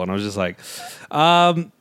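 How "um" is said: slow, higher, teasing hedge with a laugh in it; sly drawn-out dodge. Retold or imitated. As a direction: stretch it out like a cheeky non-answer. Retelling own sly reply to coworker.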